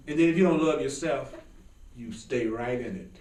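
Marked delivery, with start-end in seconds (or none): none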